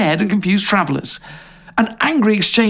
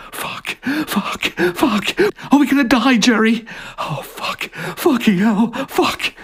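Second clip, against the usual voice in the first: higher panicked swearing; terrified cursing; 8 semitones higher, slower than usual. Acted. Fliss being dragged to the tree, terrified. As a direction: gasp out the curses in fear.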